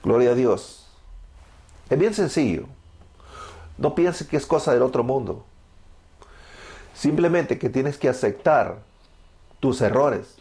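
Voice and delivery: ordinary talk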